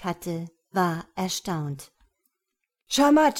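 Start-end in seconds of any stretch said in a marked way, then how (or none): 2.9-3.4 loud high excited cry; calling out. A narrator's reading. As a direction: shout it out to the crowd. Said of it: "So ging der Ruf durch die Reihen".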